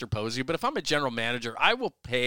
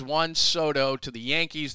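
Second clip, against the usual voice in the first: ordinary talk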